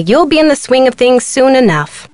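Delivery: ordinary talk, nothing marked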